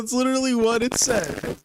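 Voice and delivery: high, incredulous insistence; insistent protest. Real, not acted. Streamer insisting to a doubting co-host, high-pitched protest. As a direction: plead that it really is written there.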